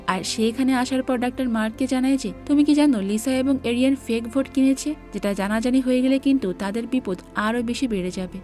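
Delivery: ordinary delivery, nothing marked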